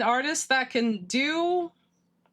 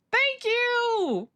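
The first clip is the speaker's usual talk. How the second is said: high thanks; 10 semitones higher than usual. Real, not acted. Thanking a donor.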